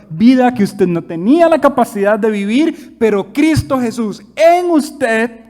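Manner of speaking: slow, loud, high-pitched preaching; preaching at full force. Acted. Preacher to congregation, slow and loud full-force preaching. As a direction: drive it home to the congregation.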